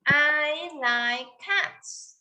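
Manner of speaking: very slow, every word drawn out; slow model sentence. Shown as a demonstration. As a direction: model it slowly for a child. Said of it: "Say again".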